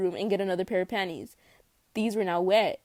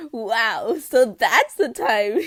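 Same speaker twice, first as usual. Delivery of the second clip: awkward; 9 dB louder, much slower than usual. A narrator's reading. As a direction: cover the embarrassment badly. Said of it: "you said awkwardly".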